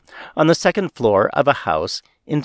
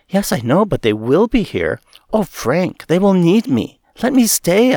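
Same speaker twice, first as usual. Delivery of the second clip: rising higher and louder, begging to stay; pleading; 7 semitones higher, 3 dB louder than usual. Acted. Dying woman begging Frank to let her stay, pleading. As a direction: build from plea to urgent begging. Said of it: "I pleaded".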